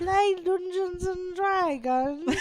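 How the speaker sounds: very slow mock British voice; Missus Doubtfire. Co-host teasing about the game, slow mock British nanny. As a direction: stretch each word in hammed-up British. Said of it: "It's me, Missus Doubtfire".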